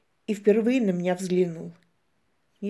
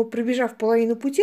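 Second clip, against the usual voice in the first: usual speaking voice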